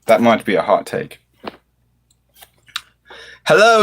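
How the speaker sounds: normal talking voice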